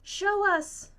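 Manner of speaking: put-on high, loud shout of a child; eager cry. A narrator's reading. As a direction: call out, impatient to see. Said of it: "cries Sam".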